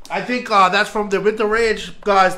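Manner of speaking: ordinary delivery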